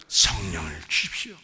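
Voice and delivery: low, whispery plea; hushed prayer. Shown as a demonstration. Pastor modelling a prayer for the Spirit, hushed and whispery. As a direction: breathe it as an earnest petition.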